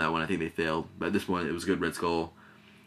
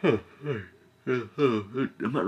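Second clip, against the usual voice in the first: yawning; 5 semitones higher than usual. Real, not acted. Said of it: "but yawning".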